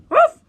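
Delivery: high, loud imitated woof; dog bark. A narrator's reading. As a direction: yelp like a dog joining in. Village dog joins the complaints.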